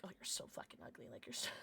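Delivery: unvoiced whisper of an inner insult; self-critical whisper. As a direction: hiss it to the mirror. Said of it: "I'm just like".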